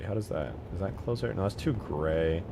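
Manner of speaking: usual speaking voice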